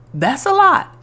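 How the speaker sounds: high, loud disbelief at the task; retelling how the huge calling felt; overwhelmed surprise. Retold or imitated. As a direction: gasp it out like it's too big. Reacting to God's big calling.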